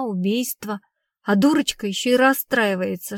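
usual speaking voice

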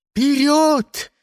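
very high, loud shout. A narrator's reading. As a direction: shout the charge. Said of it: "крикнул Яйцерик".

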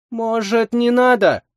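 put-on high, hesitant girl's voice; young woman voice. A narrator's reading. Vaska pleads hesitantly.

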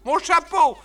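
shrill-high woman crying out in alarm; a lady shrieking as her hat is eaten; high, loud woman's cry of alarm. A narrator's reading. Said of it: "une voix de femme ... s'écrie".

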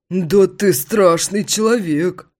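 slow, high, loud amazed friend. Acted. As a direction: gasp it in amazement. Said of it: "поражается Витя".

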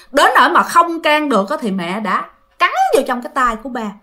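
normal talking voice

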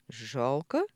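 puzzled question, rising at the end; bewildered echo. A narrator's reading. Михаил echoes his daughter's word, bewildered. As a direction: ask it back, at a loss. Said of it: "переспросил Михаил растерянно".

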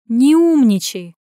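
loud, drawn-out put-down; rebuking. A narrator's reading. Tanya rebukes her smart remark, sharply scolding. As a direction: cut her off, stretching the words slowly. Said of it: "осадила Таня".

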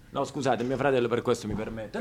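quiet aside. Acted.

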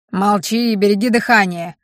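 ordinary delivery, nothing marked

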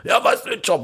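high, rough mock yob snarl; aggressive guy. Driver imitates the angry car driver insulting him. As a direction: sneer it like a hothead picking a fight. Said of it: "anbrüllte".

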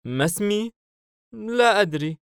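put-on very high puzzled little-boy voice; a small child taken by surprise. Child asked his name by Hayim, puzzled high voice. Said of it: "الطفل باستغراب".

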